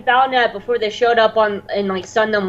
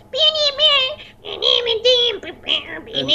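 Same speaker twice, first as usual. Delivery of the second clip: tiny squeaky band imitation, fading softer; high-pitched; 13 semitones higher, 4 dB quieter than usual. Little band playing while walking up, high-pitched. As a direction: pipe it out high like a toy band. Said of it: "it's all high pitch".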